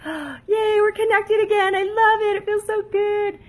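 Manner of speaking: a tiny cheering voice of the bond; giddy cord voice. Pretend. The hug bond between spouses cheering, high and giddy. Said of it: "energy umbilical cord between you that goes".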